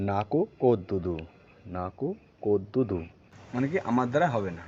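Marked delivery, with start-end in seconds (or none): 0.1-3.0 নাকু কোদ্দুদু drawn out, each syllable clear; slow Telugu demo. Shown as a demonstration. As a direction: teach নাকু কোদ্দুদু slowly. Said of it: "কীভাবে বলবে".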